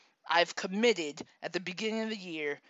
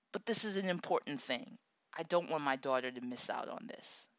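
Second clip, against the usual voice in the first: ordinary talk, nothing marked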